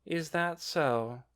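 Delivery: slow, bored drawl. A narrator's reading. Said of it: "replied in a bored voice".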